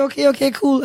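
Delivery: high, rattled okay okay cool; trying to play it cool while thrown; flustered surprise. Retold or imitated. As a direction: caught off guard and covering. Flustered after learning he's the president.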